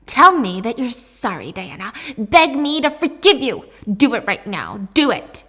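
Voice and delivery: loud, insistent acted command; forceful demand. Mom demands an apology from daughter, forceful. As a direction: order it, pushing harder each phrase.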